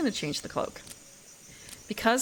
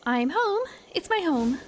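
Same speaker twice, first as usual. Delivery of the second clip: higher, lightly put-on insect lady; bee lady voice; 7 semitones higher than usual. Insect lady arriving home in the fairy realm, higher character voice. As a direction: lift the pitch for the character. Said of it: "what kind of voice does she have?".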